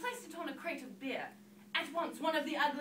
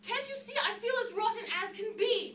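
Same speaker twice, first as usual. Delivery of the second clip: mildly indignant, higher pitch; a touch of exasperation; indignant complaint; 6 semitones higher, 4 dB louder than usual. Acted. Cinderella snaps back at fairy.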